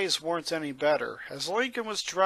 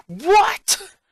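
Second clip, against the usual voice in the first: much higher shouted what; shocked yell; 10 dB louder than usual. Acted. Lincoln learns Lisa knew all along, shocked yell. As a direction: yell it in outrage. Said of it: "Lincoln yelled".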